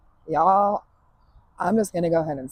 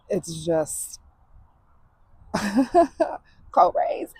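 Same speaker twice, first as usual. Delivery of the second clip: breathless teary whisper; 4 semitones higher, slower than usual. Real, not acted. Emotional breakdown into laughter.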